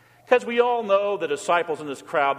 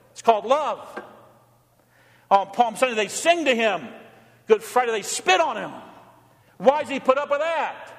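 higher, climbing preacher's call building to a fast rush; fervent pulpit preaching, higher pitched; preaching at full force; 7 semitones higher than usual. Acted. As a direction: drive it home from the pulpit, rising. Preaching climax, repeated questions.

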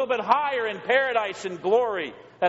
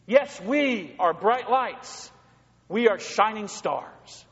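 slow emphatic preaching; 2 semitones higher, much slower than usual. Real, not acted.

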